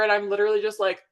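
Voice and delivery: usual speaking voice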